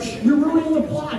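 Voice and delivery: high-pitched shushing complaint; scolding viewers. Retold or imitated. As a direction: imitate annoyed fans hushing an intruder.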